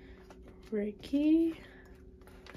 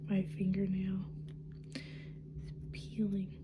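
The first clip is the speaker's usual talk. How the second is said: soft ASMR-style whisper; 2 semitones lower, 7 dB quieter than usual. Real, not acted. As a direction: hush it, close to the mic. Soft whispered unboxing chatter.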